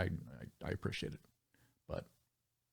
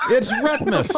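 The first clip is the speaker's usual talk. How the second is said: higher announcer voice with a laugh; announcer intro; 11 semitones higher, 4 dB louder, slower than usual. Acted. Host introducing the next guest, announcer style. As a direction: present the guest grandly.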